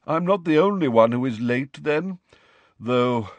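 a touch of a courtly sigh; sighing ghost. A narrator's reading. Ghost chatting with Harry in the corridor, sighing. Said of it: "he sighed".

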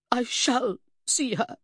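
slow, high, determined statement; firm. A narrator's reading. Mother insisting on seeing her daughter, firm. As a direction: space the words out resolutely. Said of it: "she said firmly".

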